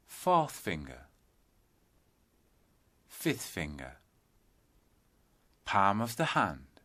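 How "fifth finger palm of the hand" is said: usual speaking voice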